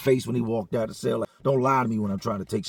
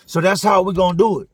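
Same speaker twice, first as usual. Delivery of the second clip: loud, high, menacing rant; threatening yell; 5 semitones higher, 5 dB louder than usual. Retold or imitated. Trap threatening to search cells, angry yell. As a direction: threaten the whole pod out loud.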